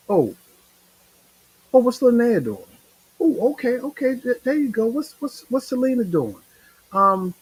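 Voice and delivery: high, chirpy nosy checking-up voice; a light mock of busy-bodies checking on friends; mock nosy browser. Mocking people clicking profile names.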